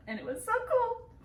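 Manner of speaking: high, bright burst of excitement; excited. Real, not acted. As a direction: gush about how cool it was. Describes seeing eagle ray.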